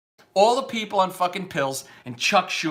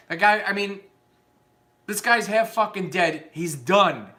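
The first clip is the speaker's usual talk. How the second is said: ordinary delivery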